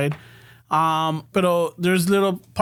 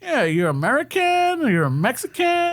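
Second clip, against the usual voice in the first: put-on slow old man explaining; 7 semitones higher, slower than usual. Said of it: "He's all like".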